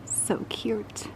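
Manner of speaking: soft unvoiced whisper to a critter. Real, not acted. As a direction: whisper so you don't scare it. Whisper to small animal.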